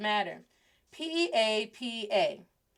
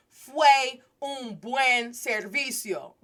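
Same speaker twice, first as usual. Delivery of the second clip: slow, higher, louder learner's Spanish; careful rehearsal; 4 semitones higher, 5 dB louder, much slower than usual. Retold or imitated. Student rehearsing her line for the priest, careful. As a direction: sound out each word deliberately.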